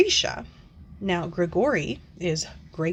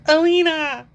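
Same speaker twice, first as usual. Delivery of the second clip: mock calling out; 10 semitones higher, 8 dB louder than usual. Reviewer mocking the audiobook's repeated name, exaggerated calling. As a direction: call the name with hammed-up drama. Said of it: "every time that they said".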